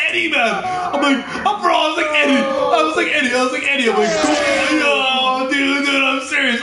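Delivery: high, loud retold yelling of a name. Retelling how he yelled at his buddy, shouting. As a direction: yell the name over and over, mock alarm.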